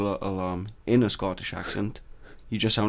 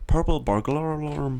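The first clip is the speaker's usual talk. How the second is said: "purple burglar alarm" in a put-on Scottish accent, higher; 5 semitones higher than usual. Shown as a demonstration. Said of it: "if you try and say it in a Scottish accent".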